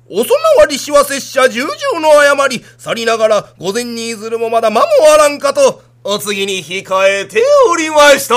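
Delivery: loud, high declaiming kabuki actor; kabuki imitation. Son answering his father as a kabuki hero, loud declaiming. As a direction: intone it like a stage samurai. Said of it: "芝居の真似してくさんねん".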